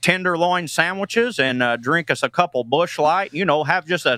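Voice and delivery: a hint of a Midwest accent, folksy and easy; Iowa Midwest accent. Comedian does his Iowa in-laws' Midwest accent for the radio host. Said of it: "this is just how my in-laws talk".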